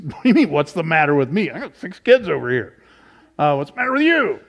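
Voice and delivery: fast, indignant retort rising to a high peak at the end; a hint of an exasperated husband snapping back; husband throwing the question back, mildly mock angry; mock mad Jacob. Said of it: "then he's mad".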